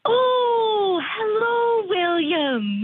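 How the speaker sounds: high-pitched, drawn-out fake British greeting; fake British accent. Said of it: "drop the British thing".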